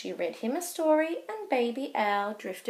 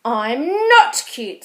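loud, slow indignant protest; 10 dB louder, slower than usual. Acted. Baby Owl protesting to Fox, loud and indignant. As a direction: push back hard.

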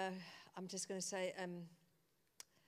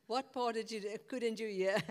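louder, laughing question to the crowd; 9 dB louder than usual. Real, not acted. Laughing at audience not hearing.

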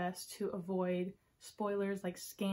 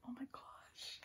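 very soft, amazed whisper; awed whisper; 4 semitones higher, 15 dB quieter, slower than usual. Real, not acted. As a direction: barely voice it, overwhelmed. Overwhelmed by the dress photo.

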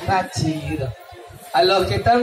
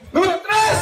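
announcing; 9 semitones higher than usual. Real, not acted. Announcing contestant.

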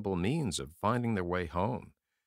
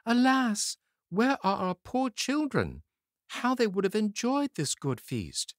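high, far-off mournful cry; lamenting mother; 12 semitones higher, 3 dB louder than usual. A narrator's reading. Mother crying to her husband over the lost children, high lament. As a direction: wail for the lost little ones. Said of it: "she cried to her husband".

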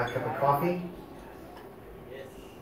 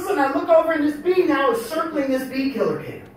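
ordinary talk, nothing marked

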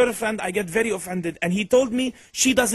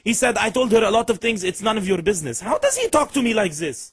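caller in a put-on Middle Eastern accent; 3 semitones higher than usual. Prank caller complaining about his girlfriend, in a Middle Eastern accent.

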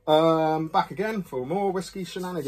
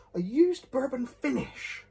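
puzzled echo; 7 semitones higher, slower than usual. Real, not acted. Puzzled by the label.

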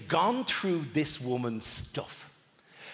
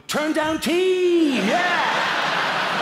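high, loud, drawn-out rallying cry; heroic team cry; 12 semitones higher, 6 dB louder, much slower than usual. Acted. Guest answering the turn down team, hammed-up triumphant cry. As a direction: bellow it like a crime-fighting squad's battle cry. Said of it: "I was supposed to go".

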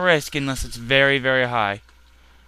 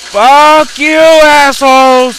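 furious shout, much higher and louder; irritated yelling; 10 semitones higher, 15 dB louder, slower than usual. Real, not acted. Gamer screaming at swarming enemies, furious shout. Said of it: "I'm getting a little irritated".

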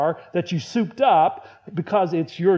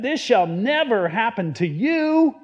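higher-pitched insistent objection; acted disciple's firm refusal; emphatic protest; 5 semitones higher, 4 dB louder than usual. Acts out Peter rebuking Jesus.

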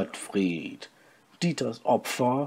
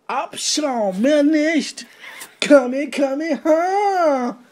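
battle cry; 5 dB louder, much slower than usual. Acted. Dieter attacks with high battle cries.

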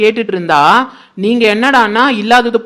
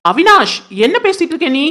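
indignant outburst; 7 semitones higher than usual. Acted. Aunt snaps at nephew in quarrel.

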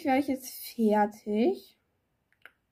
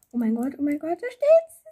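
high, loud delighted shriek; shrieking jackpot winner; 4 semitones higher, 5 dB louder than usual. Real, not acted. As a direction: shriek like you just won. Shrieking at result.

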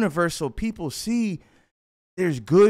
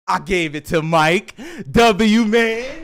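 triumphant shout; 2 semitones higher, 6 dB louder, slower than usual. Real, not acted. Telling viewers where the extra money went, triumphant shout. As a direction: let the big news burst out loud and slow. Bragging about giving money away.

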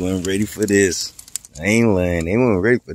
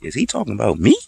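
higher-pitched imitation of a worried viewer; mock incredulous; 6 semitones higher than usual. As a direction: swoop up in mock disbelief. Voices viewers reacting to video.

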